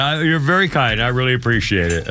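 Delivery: usual speaking voice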